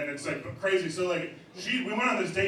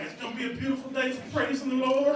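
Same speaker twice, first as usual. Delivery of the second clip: high-pitched priest impression; light mock priest, pitched up; 8 semitones higher than usual. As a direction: praise the day in a lifted, lilting voice. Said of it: "a priest definitely had to come in".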